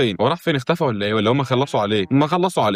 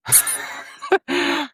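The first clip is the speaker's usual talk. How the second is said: laugh; 4 dB quieter than usual. Real, not acted. Laughing at own build.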